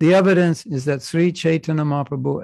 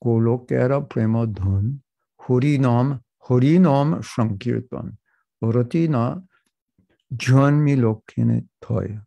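Bengali-accented chant, a rounded to o, "hori nom". Shown as a demonstration. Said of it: "if you're Bengali, you have to say".

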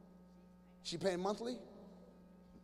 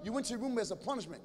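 ordinary talk, nothing marked

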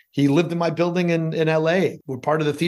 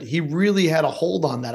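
usual speaking voice

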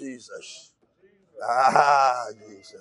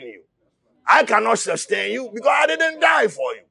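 shouted sermon, driving hard; impassioned preacher; fiery preaching; 7 semitones higher, 4 dB louder than usual. Acted. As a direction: preach it at full force to the congregation. Preaching at full force.

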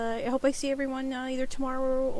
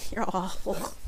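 usual speaking voice

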